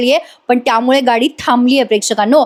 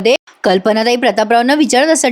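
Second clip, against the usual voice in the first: ordinary talk, nothing marked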